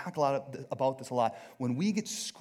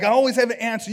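loud, high, hammed-up eagerness; trigger-happy; 9 semitones higher, 13 dB louder, faster than usual. Preacher mocking ever-ready debaters, loud trigger-happy retort. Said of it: "it's like a trigger finger".

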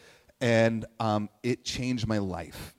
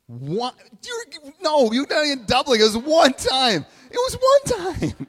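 high, loud playful outburst; teasing shout; 13 semitones higher, 8 dB louder than usual. Real, not acted. Preacher calling out his best friend, loud teasing. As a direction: call her out loudly, half laughing.

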